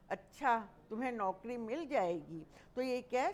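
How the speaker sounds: ordinary talk, nothing marked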